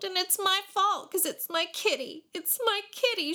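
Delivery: put-on high, mildly sobbing little girl. A narrator's reading. Little girl sobs that it is her kitty's fault. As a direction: blame yourself through a mild sob. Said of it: "she sobbed".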